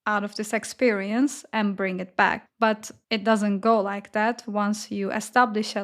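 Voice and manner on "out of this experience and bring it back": usual speaking voice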